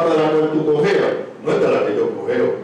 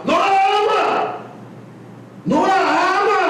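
high, slow shouted denial; 13 semitones higher than usual. Real, not acted. Preacher shouting at the men who hit their wives. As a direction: cry it out to the congregation.

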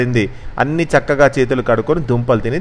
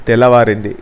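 plain reading voice

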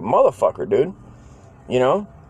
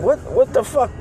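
high startled outburst; a kid discovering toys gone; shocked what the fuck; 10 semitones higher than usual. Retold or imitated. Friend noticing missing toys, cries out in high shocked disbelief.

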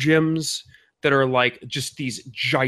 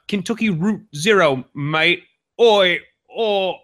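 higher, louder mock Cockney; a hammed-up Cockney, mate included; playful fake Cockney accent; 4 semitones higher, 4 dB louder, slower than usual. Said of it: "then they would go into a Cockney accent".

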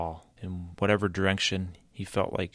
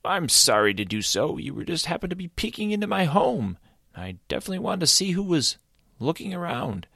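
high, quick chirpy creature voice; friendly creature; 10 semitones higher, 4 dB louder than usual. Acted. Creature explaining to Caleb why he peeked, high and eager.

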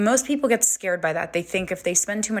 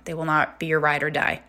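ordinary talk

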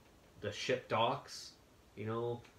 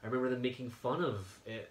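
ordinary talk

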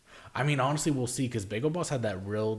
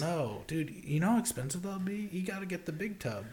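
mock friend voice; 9 semitones higher than usual.

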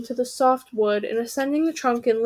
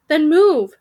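loud, higher shouted order; 4 semitones higher, 7 dB louder than usual. Acted. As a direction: bark at them to move. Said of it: "she shouted".

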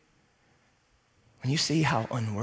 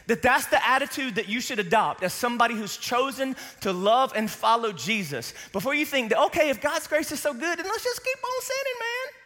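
much higher, loud, incredulous; Paul's imagined retort; 10 semitones higher, 4 dB louder than usual. Retold or imitated. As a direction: climb ever higher into mock disbelief. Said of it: "But he says".

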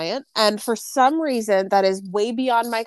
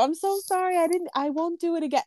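higher, softer apologetic voice with a slight country twang; mock country accent; 7 semitones higher, 4 dB quieter than usual. Elderly client promising to stop, mock country accent. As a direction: imitate the old lady pleading, lightly Southern. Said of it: "I'm giving her an accent".

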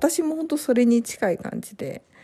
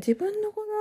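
soft, higher, half-disbelieving; wondering realization; 13 semitones higher, 4 dB quieter than usual. Retold or imitated. New mom recalling first sight of her baby, hushed wonder. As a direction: say it as if first seeing your own baby. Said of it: "不思議な感覚になりましたね".

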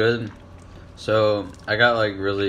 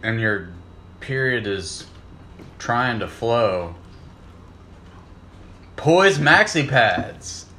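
commercial voice; slower than usual. Pretend. Spoofing a pad commercial.

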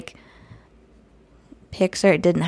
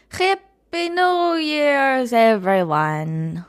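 sing-song greeting; 6 semitones higher, much slower than usual. Pretend. New Year greeting to listeners.

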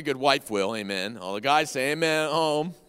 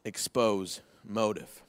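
slow repeat; 8 semitones lower, 4 dB quieter, much slower than usual. Shown as a demonstration. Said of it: "Write that down".

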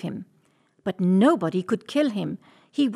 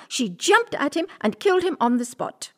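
ordinary delivery, nothing marked

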